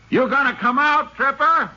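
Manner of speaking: high, carrying call into the cave. Acted. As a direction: demand an answer from the gunman. Calling into the mine.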